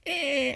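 long, drawn-out modem screech imitation; imitated dial-up noise. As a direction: hold a droning dial-up squeal. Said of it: "goes to a dial-up noise".

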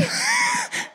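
very high, squealing laugh; high shrieking laugh. Retold or imitated. Fiancée at the proposal, high squealing laugh. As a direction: laugh up high in shocked delight.

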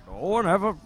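muffled villain voice through a mask; Bane voice. Retold or imitated. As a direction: do the masked brute. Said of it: "stop doing impressions of Bane and Batman".

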